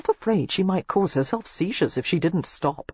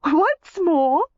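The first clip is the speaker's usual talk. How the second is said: high, louder trilling request; 12 semitones higher, 5 dB louder than usual. A narrator's reading. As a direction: trill it out flirtatiously. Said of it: "she trilled".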